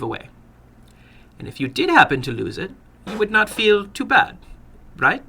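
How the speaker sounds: put-on sly older gentleman with an accent; probing the boy, a touch sly; foreign little man. Narrator voices the little man.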